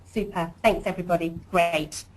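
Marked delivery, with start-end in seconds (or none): none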